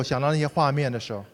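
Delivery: usual speaking voice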